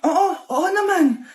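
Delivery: usual speaking voice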